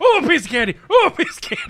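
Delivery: high, excited little exclamations; hammed-up wonder at each new treat; mock childlike delight. Mimics someone chasing each firework.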